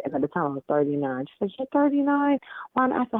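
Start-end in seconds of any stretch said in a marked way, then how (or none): 1.6-3.1 high-pitched, disbelieving friend's question; retold incredulity. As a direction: imitate a friend who can't believe it. Teammate's incredulous reply, retold.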